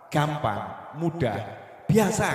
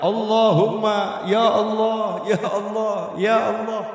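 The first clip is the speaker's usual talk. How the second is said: desperate cry to God; 5 semitones higher than usual. Retold or imitated. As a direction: call out to God like someone at the end of their rope. Desperate cry when cornered.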